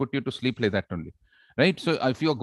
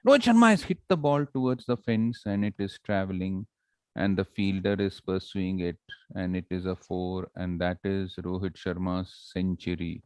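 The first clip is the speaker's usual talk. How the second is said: lifeless, monotonous play-by-play, fading down; slow, dull commentary; 6 semitones lower than usual. Shown as a demonstration. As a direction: drone through the action without any excitement. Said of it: "But suppose I say".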